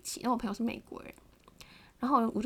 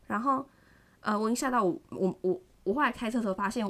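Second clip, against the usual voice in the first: usual speaking voice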